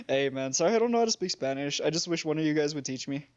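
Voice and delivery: ordinary talk, nothing marked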